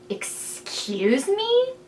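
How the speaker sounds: drawn-out, high, rising incredulous question; acted-out affronted double take; baffled disbelief. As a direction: stretch it slowly upward in shocked disbelief. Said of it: "baffled beside myself".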